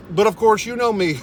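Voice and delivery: ordinary talk, nothing marked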